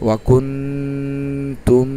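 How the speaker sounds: ওয়াকুনতুম drawn out very slowly, the nasal ন held long; ওয়াকুনতুম chanted in slow tajweed style; Quranic recitation with ghunnah. Shown as a demonstration. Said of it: "তাহলে কি হলো?".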